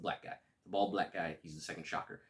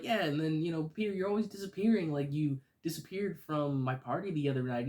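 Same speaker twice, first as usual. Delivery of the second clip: put-on very high, louder teasing girl voice; voicing Liz; 9 semitones higher, 6 dB louder than usual. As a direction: Liz complaining to Peter. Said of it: "Liz is like".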